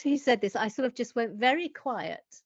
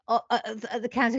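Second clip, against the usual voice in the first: ordinary delivery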